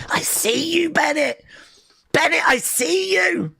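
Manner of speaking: high, delighted call-out; impressed cheer. Real, not acted. Streamer calls out to Bennett in high delight. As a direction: hype the wrestler up. Cheering wrestler's big move.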